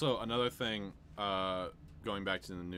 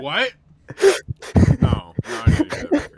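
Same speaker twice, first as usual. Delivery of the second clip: loud, much higher shocked cry of disbelief; shocked outburst; 21 dB louder than usual. Real, not acted. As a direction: shout your disbelief at a friend's pick. Reacts to shocking prediction.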